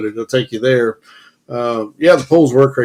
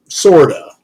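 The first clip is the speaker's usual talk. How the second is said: ordinary talk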